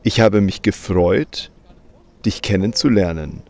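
normal talking voice

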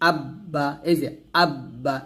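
আব্বা with the ব held double, ab then ba; আব্বা said as আব-বা, the ব sounded twice; doubled consonant. Shown as a demonstration. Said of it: "এইভাবে আমরা double উচ্চারণ করব".

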